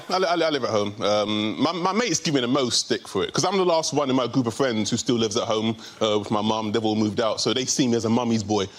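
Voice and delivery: ordinary talk, nothing marked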